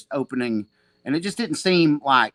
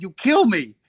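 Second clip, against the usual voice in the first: higher, louder foreign-voiced command; Seneca impression; 6 semitones higher, 7 dB louder than usual. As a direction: order him to attack. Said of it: "I got a good Seneca impression".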